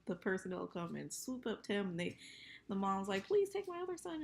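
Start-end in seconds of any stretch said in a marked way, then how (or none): 3.3-4.2 high mock pleading; mock pleading mom. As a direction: beg like a desperate mom.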